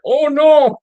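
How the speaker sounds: high, loud retold "oh no" of embarrassed fans; mock dismay. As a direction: squeal it like the hecklers caught out. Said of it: "And they're like".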